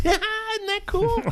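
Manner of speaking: very high, gleeful director impression; Quentin Tarantino. Director shows off head slam to kid, gleeful high voice. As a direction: enthuse in a squeaky-high voice. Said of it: "And he goes".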